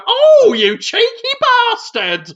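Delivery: high, loud, slow "Oh", dropping on the insult; mock scandalised squeal; imitated offended woman. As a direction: play a sitcom woman pretending outrage. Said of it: "And she'd go".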